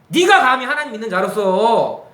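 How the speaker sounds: indignant, high-pitched telling-off; shouted rebuke. Acted. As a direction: thunder at a son as a stern father. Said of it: "소리 좀 크게 지를게요".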